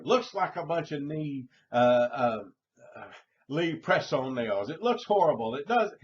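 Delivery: loud, high, indignant griping about the nails; mock furious customer berating the manicurist; angry complaint.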